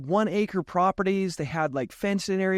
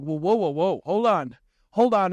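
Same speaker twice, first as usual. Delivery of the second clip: retold freak-out; 3 semitones higher, 4 dB louder than usual. Buyer halting the seller as he writes the agreement, freaking out. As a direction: act out putting the brakes on in alarm. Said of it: "I'm freaking out, right?".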